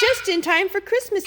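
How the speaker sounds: high, loud squeal of delight. A narrator's reading. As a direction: squeal it like an excited moose.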